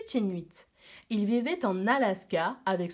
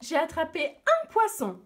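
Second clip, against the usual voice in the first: bright higher delighted announcement; happy; 7 semitones higher, 3 dB louder than usual. Acted. A small boy proudly telling his brother, happy and higher. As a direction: sound thrilled with your little catch. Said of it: "tout content".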